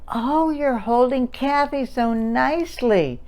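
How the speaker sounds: lightly sing-songy mother praising; a hint of a cooing mommy. Shown as a demonstration. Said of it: "Mommy might say".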